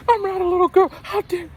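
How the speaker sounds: very high indignant squeak; squeaky mock indignation; mock offended girlfriend.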